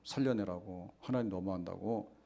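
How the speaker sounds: normal talking voice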